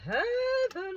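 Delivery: soaring high and loud on heaven; a sudden high, loud rapture; gushing. Real, not acted. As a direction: swoon over the food out loud. Gushing over burrata.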